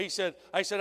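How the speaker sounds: usual speaking voice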